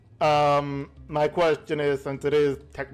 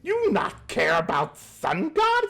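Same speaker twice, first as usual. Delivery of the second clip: indignant goblin; 11 semitones higher than usual. Acted. Goblin outraged about sun god.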